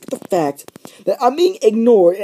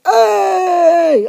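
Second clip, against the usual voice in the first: sharp high shouted hey; shouting hey across the classroom; 10 semitones higher, 5 dB louder than usual. Retold or imitated. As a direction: yell it to get the teacher's attention. Calling out to the teacher.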